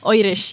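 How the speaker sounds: Irish said as "Oirish" with the vowel of "oil"; "Irish" voiced as "Oirish", lightly Irish; Irish accent word. Shown as a demonstration. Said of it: "the only way I can get into an Irish accent is by saying Irish".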